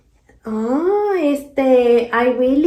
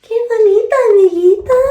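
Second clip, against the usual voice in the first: high, loud sing-song call; high playful greeting; 6 semitones higher, 8 dB louder, faster than usual. Acted. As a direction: coo it out brightly like greeting a friend.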